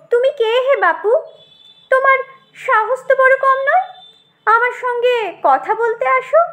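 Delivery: high-pitched snooty madam; haughty tomato, indignant. Acted. Tomato rebuffs the brinjal for daring to speak, haughty. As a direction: look down on an upstart in a high voice.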